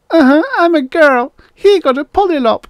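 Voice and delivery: put-on very high, loud little girl; high-pitched kid complaint; indignant little girl. A narrator's reading. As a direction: protest like a small child. Little girl protests to the robot.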